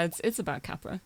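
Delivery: usual speaking voice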